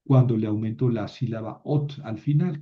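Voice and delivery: ordinary delivery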